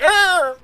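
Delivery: a squeaky pained arr, hammed up; high mock cry. Monster hit by a rock on the road, hammed-up high cry. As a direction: yelp it high like a pebble felled him. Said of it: "he'd be like".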